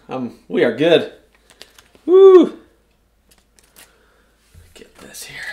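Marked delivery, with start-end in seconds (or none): 2.1-2.6 high, loud whoop; excited whoop. Real, not acted. Breaker celebrating a card for viewers, loud and high. 4.7-5.4 hushed whisper. Real, not acted. Breaker teasing the next card to viewers in a whisper.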